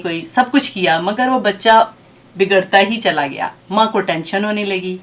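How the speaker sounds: ordinary delivery, nothing marked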